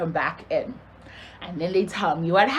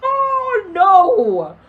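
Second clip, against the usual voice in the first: dismayed reaction; 9 semitones higher, 6 dB louder than usual. Retold or imitated. Retold dismay at hearing a fight.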